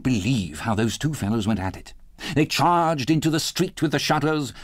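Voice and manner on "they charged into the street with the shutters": ordinary delivery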